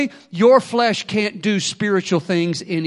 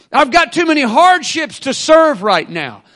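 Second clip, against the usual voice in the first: louder, higher, hammered; preaching mock excuses; 6 semitones higher, 6 dB louder than usual. Voicing excuses at full force.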